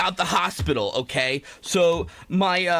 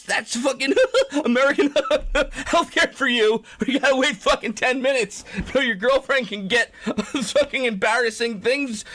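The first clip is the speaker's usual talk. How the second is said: laughing through words; 4 semitones higher than usual. Real, not acted. Laughs mocking American healthcare.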